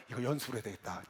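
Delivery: low, soft note to self; private resolve. Retold or imitated. Trainee deciding he must practise the shout, quiet resolve. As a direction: say it quietly to yourself.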